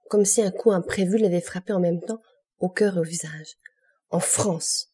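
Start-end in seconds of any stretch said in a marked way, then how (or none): none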